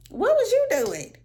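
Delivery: high, loud disbelieving shout; incredulous outburst. Real, not acted. To the passenger who did nothing, high incredulous shout.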